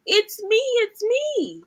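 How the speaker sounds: high, slow, sing-song reveal; squeaky little animal cheering it's me. Acted. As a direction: pop out from behind the mask. Horse revealed under mask.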